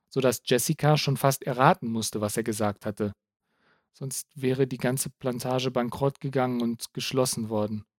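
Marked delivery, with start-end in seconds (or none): none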